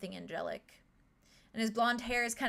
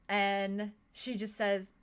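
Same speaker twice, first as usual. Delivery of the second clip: normal talking voice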